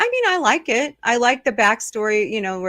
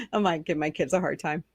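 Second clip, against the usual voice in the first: ordinary talk, nothing marked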